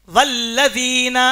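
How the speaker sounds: والذین drawn out slowly with a held double L; slow demonstration of والذین, lam pronounced twice; doubled consonant. Shown as a demonstration. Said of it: "یہ لفظ دو بار پڑھنا ہے".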